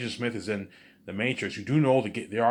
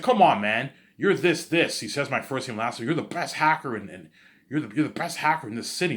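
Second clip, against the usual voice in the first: excited praise; 5 semitones higher, 6 dB louder than usual. Acted. Guy excitedly praising the hacker.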